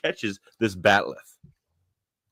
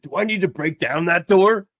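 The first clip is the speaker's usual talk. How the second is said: much higher, louder eager offer; eager Shaxx; 11 semitones higher, 7 dB louder than usual. Retold or imitated. Shaxx offering to smash the door, eager loud offer. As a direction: ask it like a keen brute. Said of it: "He's like".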